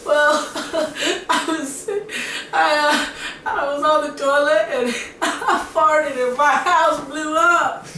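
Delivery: high-pitched giggling confession, loud; mock hysterics, barely getting through it; laughing hysterically. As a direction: squeeze the words out between hammed-up laughs. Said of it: "why are you laughing?".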